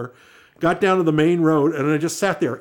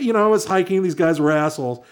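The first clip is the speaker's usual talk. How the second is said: normal talking voice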